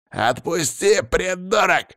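high, strained angry yell without much volume; high-pitched acted scream; shouting. A narrator's reading. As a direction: cry out furiously to be freed. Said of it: "закричал он".

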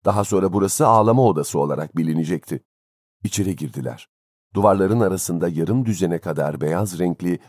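usual speaking voice

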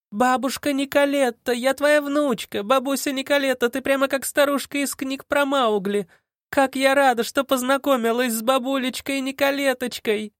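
high gleeful shouting; shouting gleefully. Acted. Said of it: "крича на сей раз".

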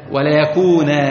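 وليكونا ending on a long alif; pausal alif ending. Shown as a demonstration. Teacher showing students the pausal form, slow. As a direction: stop on وليكونا with the nun turned to a drawn-out alif. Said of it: "لو أردت أن تقف على النون الثانية".

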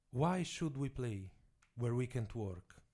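slow, deliberate English question; each word weighed, slowly. Acted. (domanda lenta e scandita in inglese)

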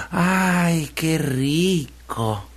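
very slow, drawn-out relish; contented savouring. Acted. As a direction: sigh it out with pleasure, stretching each word. Piglet eating cookies contentedly. (cerdito saboreando, muy despacio)

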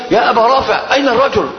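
high urgent call pretending to help; like a rescuer. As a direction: sound like someone coming to help. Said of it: "كالمغيث له".

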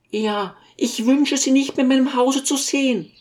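very high, put-on lady's voice; dismissive lady. A narrator's reading. Lady bans the teacher from her house, high-voiced and firm. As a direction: dismiss him in a high character voice.

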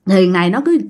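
normal talking voice

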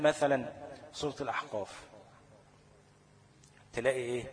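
ordinary talk, nothing marked

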